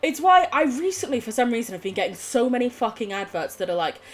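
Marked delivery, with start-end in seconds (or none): none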